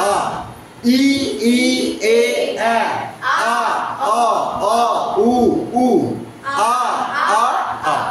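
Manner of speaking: steady chant from "ই" through "উ" to "আ"; teacher leading "ই, ই, এ, আ" and on, evenly spaced; twelve-vowel drill. Shown as a demonstration. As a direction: sound out each vowel from "ই" to "আ" separately. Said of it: "এবার একসাথে আবার বলো".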